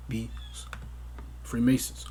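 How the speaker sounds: a hushed, conspiratorial aside; whispered secret. Real, not acted. Conspiratorial aside about Freemasons.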